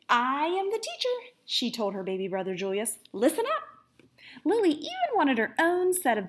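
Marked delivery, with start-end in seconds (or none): 0.1-1.3 playing teacher. A narrator's reading. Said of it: "Lily pretended to be Missus Drollerit". 3.1-4.0 playing teacher. A narrator's reading. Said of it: "Lily pretended to be Missus Drollerit".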